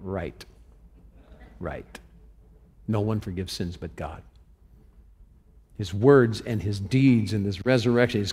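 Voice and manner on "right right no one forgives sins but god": a soft, dry, knowing aside, much quieter. Retold or imitated. Jesus answering the doubting scribes, soft and wry. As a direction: drop almost to a murmur, wry and knowing. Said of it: "I would have said".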